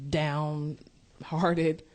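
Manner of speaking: ordinary talk, nothing marked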